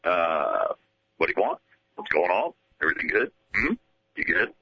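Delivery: low, muttering manager check-in; Jim Leyland impression. Leyland quizzing the host in his office, low grumble. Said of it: "a little more of that".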